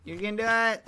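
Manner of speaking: low, loud cheerleader; deep cheering voice. Acted. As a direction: boom encouragement deep. Mock cheering.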